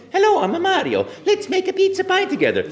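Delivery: put-on very high, loud cheerful Italian accent; bubbly Italian plumber inviting you to play; Mario voice. Actor's first-ever Mario line at the audition, high and loud. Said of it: "Just in the voice that you hear today".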